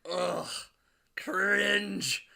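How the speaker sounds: high, pouting cringe; disgusted groan. Retold or imitated. Reacting to the movie, a mock disgusted cringe. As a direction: recoil from the screen. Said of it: "I would just go".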